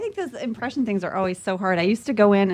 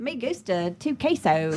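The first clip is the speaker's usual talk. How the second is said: put-on slow Spanish with an American Southern accent; hammed-up gringo Spanish accent; George W. Bush; slower than usual. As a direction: drawl the Spanish like a Texan. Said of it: "doing George W. Bush doing Spanish".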